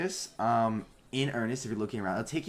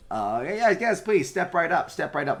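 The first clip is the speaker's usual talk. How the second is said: barker call; 8 semitones higher, 8 dB louder than usual. Acted. Automaton barker calling to crowd.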